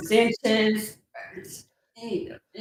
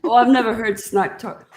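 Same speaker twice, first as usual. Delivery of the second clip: usual speaking voice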